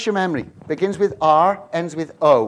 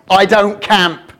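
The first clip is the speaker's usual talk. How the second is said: sassy refusal; 9 dB louder, much slower than usual. Pretend. Wife refusing, hands on hip.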